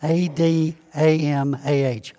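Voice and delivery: ordinary talk, nothing marked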